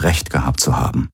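ordinary delivery, nothing marked

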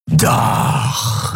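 rasping, voiceless creature reply; slow hissed retort from a reptile. A narrator's reading. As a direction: drag the word out in a reptilian rasp. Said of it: "erwiderte das Reptil".

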